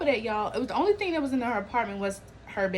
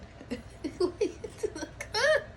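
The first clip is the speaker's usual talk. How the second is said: high cackling laugh; 11 semitones higher, 3 dB quieter than usual. Real, not acted. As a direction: burst out laughing. Laughs at own joke.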